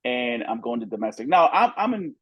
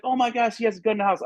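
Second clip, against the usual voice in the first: high frantic female voice; imitating a panicked woman, pitched high; 9 semitones higher, faster than usual. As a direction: voice the scared girlfriend up high. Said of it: "She's like".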